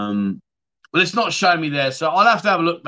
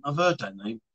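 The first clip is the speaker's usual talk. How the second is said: much softer and lower, heard barely lifted; muttered repeat; 6 semitones lower, 8 dB quieter than usual. Real, not acted. Echoing a viewer's comment, softly muttered to himself. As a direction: mutter it again to yourself.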